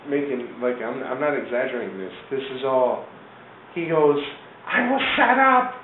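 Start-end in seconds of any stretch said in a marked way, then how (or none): 4.7-5.7 high, loud imitated wail; mock protest. Charlie collapsed before the cops, high mock protest. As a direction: cry foul in his put-on high voice.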